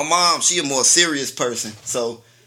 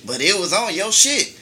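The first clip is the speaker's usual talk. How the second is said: defensive protest; 6 semitones higher, 4 dB louder than usual. Retold or imitated. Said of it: "I'm like".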